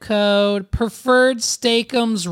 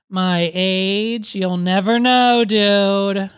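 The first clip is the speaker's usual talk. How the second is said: ordinary talk, nothing marked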